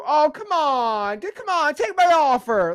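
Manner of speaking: high, slow, begging whine; pleading desperate buyer. Retold or imitated. Wholesaler begging a hesitant seller, high whine. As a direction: beg the seller like you're desperate. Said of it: "you're gonna sound like crazy".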